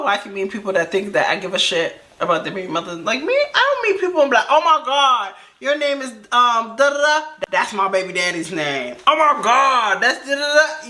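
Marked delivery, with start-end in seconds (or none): 4.5-10.9 high, hammed-up excitement; put-on high squeal of recognition; mock gushing. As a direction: gush like an over-excited new acquaintance, mockingly. Mocks people gushing over a name.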